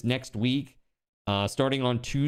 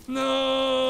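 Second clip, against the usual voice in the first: panicked no; 12 semitones higher than usual. Real, not acted. About to die in-game.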